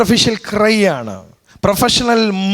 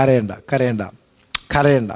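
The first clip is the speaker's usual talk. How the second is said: lower, softer soothing words; preacher voicing the Lord softly comforting those who truly weep; comforting the grieving; 7 semitones lower, 4 dB quieter than usual. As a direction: hush them gently like a grieving child. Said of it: "റിയലായിട്ട് കരയുന്നവരോട് കർത്താവ് പറയാം".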